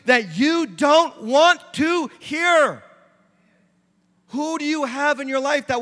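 loud, rising preacher's exhortation; full-force preaching. Acted. Pastor pleading with the congregation, loud and rising. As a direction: drive it home like a pulpit call.

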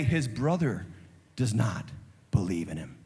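usual speaking voice

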